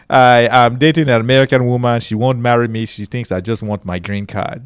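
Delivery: deadpan joke in a Nigerian accent. Shown as a demonstration. Comedian's immigrant joke on stage, Nigerian accent. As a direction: deliver the line as a Nigerian immigrant. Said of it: "do a Nigerian accent".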